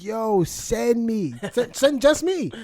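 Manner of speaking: high, slow, begging to be sent; eager younger self. Retold or imitated. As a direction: plead to go like a reckless kid. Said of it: "I was like".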